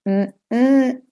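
"Mm-mm-mm" hummed high on the second mm; pitch goes up. Shown as a demonstration. Teacher lets learners hear stress, "Mm-mm-mm" going up in the middle. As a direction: lift the middle of "Mm-mm-mm". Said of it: "You can hear it go up".

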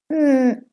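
"Mm" hummed high; pitch goes up. Shown as a demonstration. Teacher isolates the stressed syllable, high "Mm". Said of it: "You can hear it go up".

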